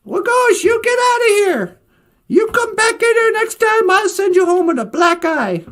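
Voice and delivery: high, loud angry scolding; screaming hen. Acted. Baka Akwe chases the fox off, hammed-up high yell. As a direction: holler it up high like a furious hen. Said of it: "Baka Akwe screaming at somebody".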